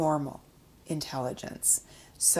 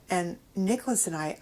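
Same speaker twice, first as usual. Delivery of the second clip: ordinary delivery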